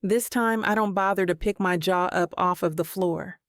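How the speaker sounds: ordinary delivery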